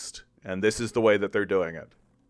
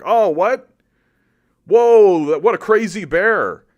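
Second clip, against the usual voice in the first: high, loud mock astonishment; sarcastic fake shock at the bear; mock surprise; 9 semitones higher, 9 dB louder than usual. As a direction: play dumb, shocked and squeaky. Mocking naive Westerners' surprise.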